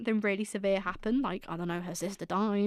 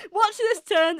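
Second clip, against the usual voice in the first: laughing; 10 semitones higher, 6 dB louder, slower than usual. Real, not acted. Laughing aside.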